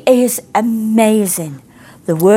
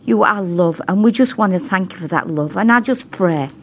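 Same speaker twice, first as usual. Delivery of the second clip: impassioned praying, pitched higher; fervent prayer; 4 semitones higher than usual. Real, not acted. Preacher thanking the Father for his love, fervent prayer. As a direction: pour out earnest thanks to God.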